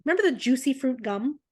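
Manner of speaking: ordinary delivery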